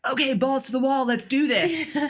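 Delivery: playfully fired-up battle cry; mock pumped-up. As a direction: hype yourself up with a laugh. Pumped-up resolve, laughing.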